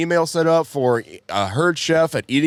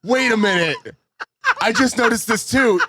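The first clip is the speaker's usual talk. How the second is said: ordinary talk, nothing marked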